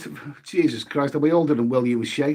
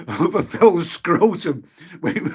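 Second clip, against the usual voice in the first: laughing; 3 semitones higher than usual. Real, not acted. Laughing at a rude name.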